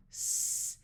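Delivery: unvoiced hiss of s, no voice at all; a long breathy s hiss. Shown as a demonstration. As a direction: hiss the s with no buzz in the throat. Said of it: "while you do the sound".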